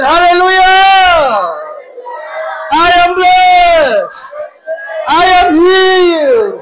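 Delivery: loud, high, slow shouted affirmations; shouted declaration. Acted. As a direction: shout it out with the congregation. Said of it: "Sabihin natin".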